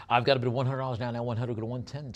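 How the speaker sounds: rapid-fire auction patter, slightly lifted; auctioneer's bid call. Acted. Auctioneer calling bids.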